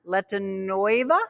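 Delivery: careful syllable by syllable; slow, uncertain name attempt; slow careful pronunciation. Real, not acted. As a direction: sound out the name slowly. Careful try of a hard name.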